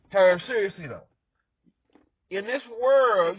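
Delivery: ordinary delivery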